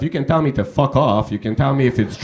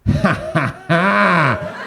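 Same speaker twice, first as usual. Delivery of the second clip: a showy fake laugh, hammed up; mocking laugh; 2 semitones higher, much slower than usual. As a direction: mimic a smug fake laugh, ha ha ha. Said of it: "the weirdest reaction so far was".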